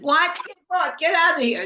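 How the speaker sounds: offended Brahmin ordering Narada out; angry dismissal. Acted. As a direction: drive him away in outrage.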